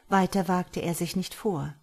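ordinary delivery, nothing marked

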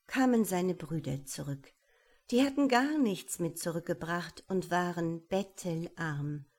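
ordinary delivery, nothing marked

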